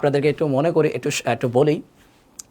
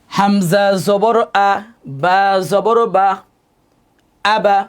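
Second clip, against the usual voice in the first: slow, loud spelling chant; recitation drill; 5 semitones higher, 5 dB louder, much slower than usual. Shown as a demonstration. Teacher showing how two letters join, chanted drill. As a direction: stretch each letter, then join them firmly.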